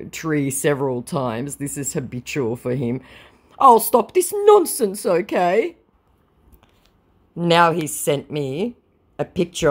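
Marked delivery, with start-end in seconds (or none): 3.6-5.8 high, loud mock promise; hammed-up pleading, pitched high; pleading scammer. Voices scammer's pleading message.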